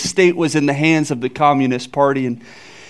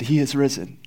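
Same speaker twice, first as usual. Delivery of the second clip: softer, hushed first "He is risen."; quiet proclamation; 7 dB quieter than usual. Acted. Old priest first tells the townspeople, softly. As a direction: say "He is risen." gently, nearly to himself. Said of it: "he said".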